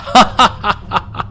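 a high, breathy villain's cackle; evil cackle. Acted. Shishupala mocks Krishna's strength, high evil cackle. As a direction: cackle with wicked glee. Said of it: "వికటాట్టహాసం చేసి".

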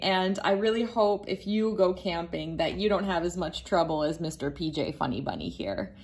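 plain reading voice

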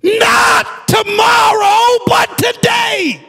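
high, loud climactic preaching; shouted, rising sermon climax; full-force preaching. Acted. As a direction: build the sermon to a roaring peak.

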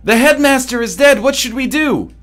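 high, loud hammed-up commotion; excited shouting. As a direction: shout it like a mock rallying cry. Acting out excited children's reaction.

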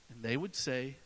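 ordinary delivery

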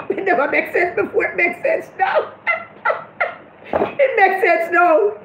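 high, laughing mock realisation. Real, not acted. Laughing as the Mark joke finally makes sense. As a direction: giggle out the punchline.

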